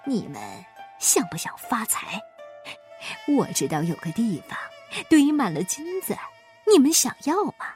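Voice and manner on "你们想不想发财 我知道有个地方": low, half-whispered temptation; soft, secretive offer from a schemer; conspiratorial hush. A narrator's reading. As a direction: lure them in under your breath. Schemer secretly tempts two vagrants.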